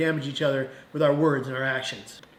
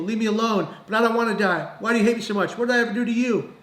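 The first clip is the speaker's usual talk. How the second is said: higher-pitched, half-raised pleading yell; retold yell; 6 semitones higher than usual. Young man lashing out at a crowded bus, retold yell. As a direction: re-enact crying out, pitch climbing. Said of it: "I began to yell out".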